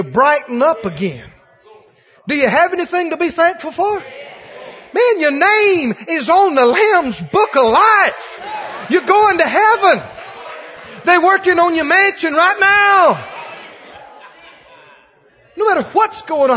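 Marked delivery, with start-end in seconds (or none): 4.9-16.6 high, loud preaching shout. Real, not acted. Preacher shouting to the congregation about heaven, loud and high.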